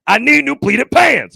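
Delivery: high, loud, drawn-out insistence; indignant yell. Retold or imitated. Dealer defending the Macy's meeting spot, indignant yell. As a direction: yell it slowly, defending yourself.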